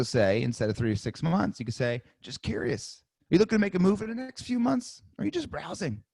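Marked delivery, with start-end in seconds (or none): 2.2-6.0 higher, curious lift on the question; sales call tonality. Shown as a demonstration. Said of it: "Hear my tonality".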